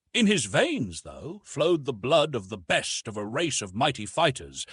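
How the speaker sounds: usual speaking voice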